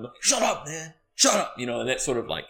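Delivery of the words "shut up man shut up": higher-pitched yell held back in volume; mock yell. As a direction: imitate an annoyed audience member yelling. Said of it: "trying to yell at him".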